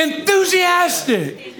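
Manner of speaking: "Enthusiastic" sung out high, slow and stretched. Shown as a demonstration. Preacher showing the church how to say "Enthusiastic" with gusto. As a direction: belt out "Enthusiastic" with real joy. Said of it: "But say it enthusiastic".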